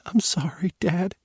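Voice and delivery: high, breathy whispered sorry through tears; tearful apology. Acted. Daughter to her father on a video call, whispering a tearful apology. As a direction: whisper it, near breaking. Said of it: "she kept apologizing".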